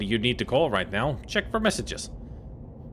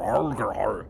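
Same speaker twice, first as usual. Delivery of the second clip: mock pirate growl; 4 semitones lower than usual. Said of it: "Gabby doesn't talk like that".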